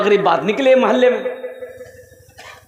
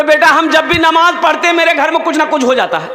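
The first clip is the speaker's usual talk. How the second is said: said again louder and higher for a hard-of-hearing listener; repeated louder; 5 semitones higher, 4 dB louder than usual. Shown as a demonstration. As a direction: repeat it up loud so he hears. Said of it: "की बोली जाओ?". (दोबारा ऊँची आवाज़ में कहना)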